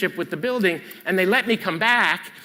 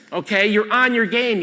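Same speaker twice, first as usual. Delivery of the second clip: usual speaking voice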